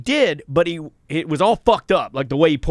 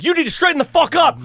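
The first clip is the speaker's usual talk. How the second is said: high-pitched angry order; hot-headed; 12 semitones higher, 4 dB louder, faster than usual. Retold or imitated. Telling off the drunk driver, retold hot-headed anger. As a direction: re-enact a heated telling-off. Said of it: "'cause I'm hot".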